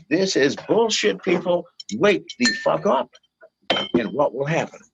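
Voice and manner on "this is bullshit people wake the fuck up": high, pushed call to wake up; rally the crowd with a raised, indignant cry; mock outraged shout. Urging listeners to post online, high outraged shout. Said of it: "Go onto your social media and say".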